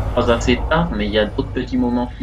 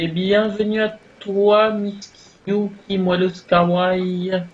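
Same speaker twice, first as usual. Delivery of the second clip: high, slow sing-song character voice; high put-on voice; 8 semitones higher, slower than usual. Player welcoming Miss Yuki in a high slow put-on voice. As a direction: draw it out high like a cartoon host.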